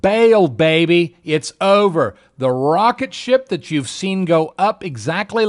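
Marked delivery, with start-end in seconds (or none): 0.0-2.2 louder, slow, drawn-out alarm call; slow loud warning to get out now; urgent warning. Retold or imitated. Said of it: "My technicals said right here".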